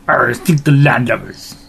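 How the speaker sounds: gruff pirate grumble. Acted. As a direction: sneer it like an old sea dog. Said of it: "all my pirate talk".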